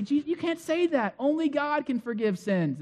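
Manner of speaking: mock indignant protest, pitched high; upset religious leaders. Religious leaders object to Jesus forgiving sins, mock upset. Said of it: "upset the religious leaders like crazy".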